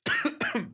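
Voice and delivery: sudden choking cough; coughing fit. Real, not acted. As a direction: cough on a swallow of water. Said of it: "I'm choking on my own water".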